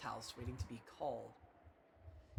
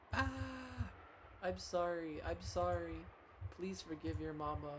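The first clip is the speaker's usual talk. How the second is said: crying apology; 5 semitones higher, slower than usual. Acted. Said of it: "crying and begging forgiveness".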